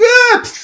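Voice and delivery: high, loud startled scream; mock scream of the man with the tire iron. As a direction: let out a sudden yelp of fright. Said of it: "He's like".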